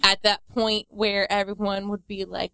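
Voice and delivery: normal talking voice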